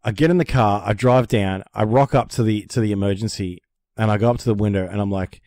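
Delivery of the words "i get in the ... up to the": ordinary talk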